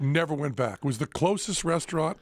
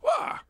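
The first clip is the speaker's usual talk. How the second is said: sudden high yelp of surprise; a sharp, high startled cry. Retold or imitated. Sudden startled cry while retelling.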